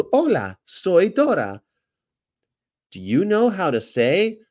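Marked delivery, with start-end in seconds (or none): 0.1-1.6 Dora impression.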